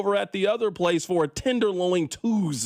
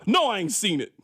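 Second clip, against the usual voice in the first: usual speaking voice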